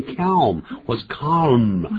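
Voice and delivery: both versions of "calm" drawn out slowly with a sounded L; tongue curled for L. Shown as a demonstration. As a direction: slowly mispronounce "calm", L audible before M. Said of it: "都不要舌头卷起来".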